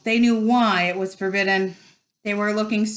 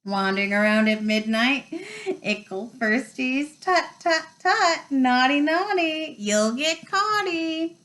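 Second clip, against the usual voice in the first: gleeful taunting; 6 semitones higher than usual. A narrator's reading. Peeves taunts pupils out of bed at midnight, gleeful sing-song. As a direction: chant it like a naughty imp. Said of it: "Peeves cackled".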